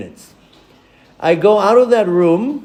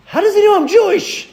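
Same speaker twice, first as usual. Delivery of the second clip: high, baffled inner question; mock astonished thought; puzzled surprise; 3 dB louder than usual. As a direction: wonder out loud in disbelief.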